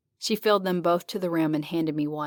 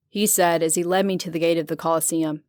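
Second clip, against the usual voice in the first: ordinary delivery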